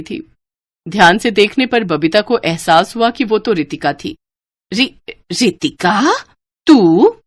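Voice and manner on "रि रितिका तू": slow, stunned stammer; shocked stammer. A narrator's reading. Sister-in-law recognising the inspector, stunned. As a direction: stumble over the name in disbelief.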